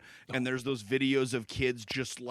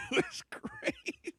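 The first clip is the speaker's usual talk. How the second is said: high squeaky laugh through drawn-out words; helpless real laughter, slowed words; 4 dB quieter, slower than usual. Real, not acted. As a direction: barely get it out through laughter. Cracking up at the joke.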